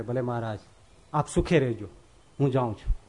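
a retold devotee bowing out softly; humble quiet assent. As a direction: accept the banishment meekly. Dada humbly accepts leaving.

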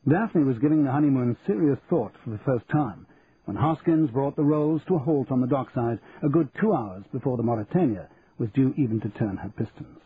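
ordinary delivery, nothing marked

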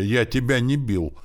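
high, loud protest; indignant denial. A narrator's reading. Boy blamed for Zert's sore jaw, indignant denial. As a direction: deny it with offended force. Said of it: "возмутился я".

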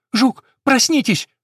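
high, loud panicked call; urgent shout. Acted. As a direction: wake the dog in a panic. Sparrow wakes the dog.

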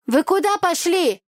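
high called-out question across the crowd; shout. A narrator's reading. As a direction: yell after them through the dancers. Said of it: "крикнула нам Оля".

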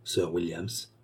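softly murmured in stupor; stunned murmur. A narrator's reading. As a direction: breathe the name in shock. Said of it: "murmura avec stupeur".

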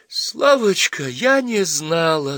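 put-on girl weeps to her suitor, apologetic; tearful. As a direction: say it as tears come. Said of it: "заплакала Варя".

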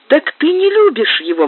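high-pitched joyful exclamation; exclaiming. A narrator's reading. Anna learning her sister doesn't love him, exclaiming. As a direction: burst out with relief through tears. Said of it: "воскликнула Анна".

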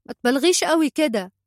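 sharp, dismissive call; calling out in rebuke. A narrator's reading. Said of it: "فصاح بها هادي".

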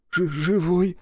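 put-on high child voice stuttering with cold; teeth chattering. A narrator's reading. Freezing boy tells Рух he's alive, teeth chattering. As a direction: chatter it through clenched teeth. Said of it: "Гришка приклацнул зубами".

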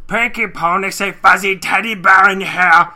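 loud, high put-on monster voice; cave troll. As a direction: bellow like a big dopey cartoon troll. Cave troll's lines acted out.

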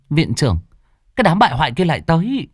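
frightened alarm. A narrator's reading. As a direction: hurry out the bad news in a mild panic. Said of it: "rất sợ hãi".

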